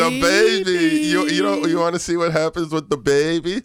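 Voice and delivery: high, loud, eager tease; excited hyping. Real, not acted. Co-host luring Johnny back to the show, excited hyping. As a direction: hype it up in a high voice. Hyping topic to stop walkout.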